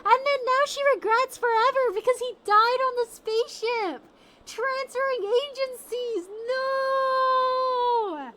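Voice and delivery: high, loud wailing protest; anguished outcry. Real, not acted. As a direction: wail it in despair. Anguish over the story.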